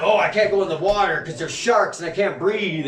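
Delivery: hammed-up whine of a scared follower; mocking the fearful. Mimicking people who go along with the program, mocking. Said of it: "when you go along with the program".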